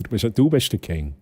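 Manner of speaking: ordinary talk, nothing marked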